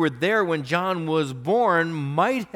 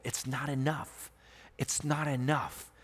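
quiet emphasis; 8 dB quieter than usual. Real, not acted. Solemn quiet emphasis in sermon.

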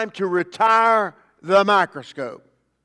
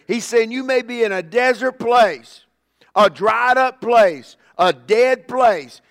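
loud, ringing proclamation with stretched key words; forceful preaching; 4 semitones higher, 4 dB louder than usual. Real, not acted. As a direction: proclaim God's promise with full conviction. Preaches God's promise at full force.

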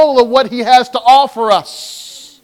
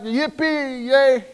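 half-hearted cheer; 7 dB quieter, slower than usual. Retold or imitated. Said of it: "They were like".

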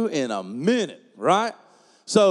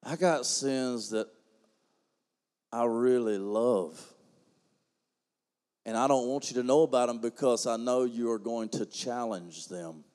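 quiet confession; 11 semitones lower, 8 dB quieter than usual. Acted. Preacher confessing hidden sins, low and hushed.

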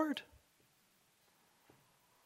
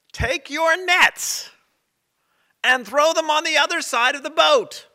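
calling out; 10 semitones higher, 6 dB louder than usual. Acted. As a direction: call out to the boat from the shore. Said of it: "The person cries out to them".

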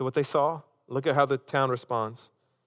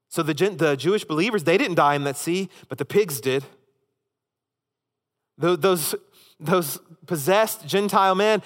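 raised, louder preaching voice pressing each point home; full-force preaching; 6 semitones higher, 5 dB louder than usual. Acted. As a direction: preach it hard, climbing higher and louder.

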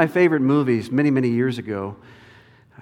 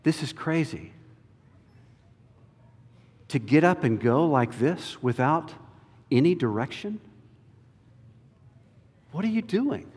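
doubting inner voice; 4 dB quieter than usual. Acted. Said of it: "the temptation that Abraham would face".

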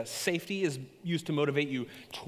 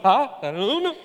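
jumpy nervous; 8 semitones higher, 7 dB louder than usual. Retold or imitated. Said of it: "I was like".

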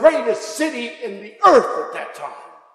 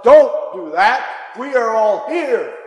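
loud, slow call to stop him; urgent shout; 4 semitones higher, 7 dB louder, slower than usual. Acted. Paul shouts to the jailer to stop him, urgently. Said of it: "And Paul shouts out".

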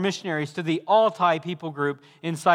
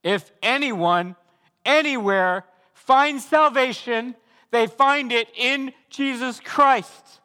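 higher, slow and weighty; slow emphatic preaching; 7 semitones higher, 5 dB louder, slower than usual. Acted. Preacher proclaiming salvation to all, slow emphatic preaching. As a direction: land each word like a pulpit proclamation.

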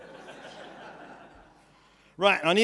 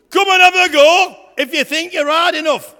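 booming shouted challenge, much louder; Goliath's challenge; 8 semitones higher, 13 dB louder than usual. Acted. Goliath taunting the Israelite army, loud bellowing shout.

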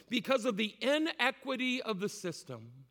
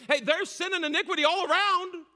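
alarmed prophet; 10 semitones higher, 6 dB louder, faster than usual. Retold or imitated. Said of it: "he starts out saying".

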